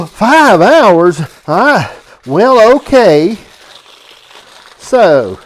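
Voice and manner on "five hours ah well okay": high, loud shocked gasp and reply; mock shocked gasp. Daughter on the phone, faking shock at five hours. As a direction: act stunned by the wait. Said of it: "I said".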